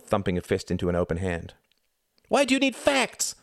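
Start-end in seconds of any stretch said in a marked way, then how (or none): 2.3-3.4 very high, shrill challenge; shrill demand. A narrator's reading. Ruth challenging Reginald, shrill. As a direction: demand it shrilly, pitch right up. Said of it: "her voice shrill".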